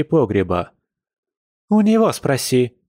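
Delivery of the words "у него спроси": high-pitched cross retort; understated angry snap; mild growled retort. A narrator's reading. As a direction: snap it back, mildly irritated. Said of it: "рыкнула Маша".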